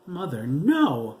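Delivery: high, loud, drawn-out cry; anguished cry. A narrator's reading. Daughter crying out to her mother, loud anguish. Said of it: "Maria cried".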